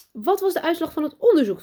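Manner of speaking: high, loud, eager question; can hardly wait for the answer; trembling with excitement. A narrator's reading. Teacher asking about the boy's brain, eagerly excited. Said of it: "trilt van opwinding".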